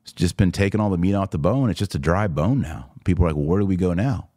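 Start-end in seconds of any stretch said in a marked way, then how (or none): none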